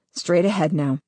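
ordinary delivery

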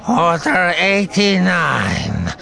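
high, loud, long-drawn alien call; stretched creature voice from a pickup window. A narrator's reading. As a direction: call the order slowly as an alien clerk. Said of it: "a voice called from the pickup window".

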